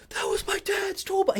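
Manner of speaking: hoarse, breathy mock whisper of panic; imitating a frantic dad in a hushed croak; distraught whisper. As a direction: whisper it like a man losing his mind. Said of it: "losing his mind".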